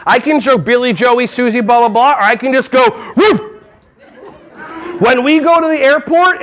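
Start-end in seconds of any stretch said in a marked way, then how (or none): 3.2-3.4 one short high woof; dog bark. Shown as a demonstration. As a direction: imitate a dog to grab attention. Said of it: "animal noises".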